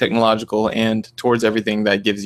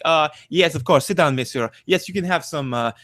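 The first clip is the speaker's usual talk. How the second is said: mock French accent; 6 semitones higher than usual. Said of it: "in France, are they like".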